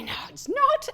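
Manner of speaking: high-pitched, protesting squeak of denial; mock denial. Lecturer acts disputing a friend's verdict, high mock denial. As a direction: protest playfully, voice shooting up.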